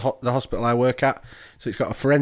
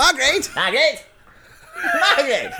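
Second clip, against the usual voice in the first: high calling out across house; 13 semitones higher than usual. Pretend. Husband calling wife Margaret.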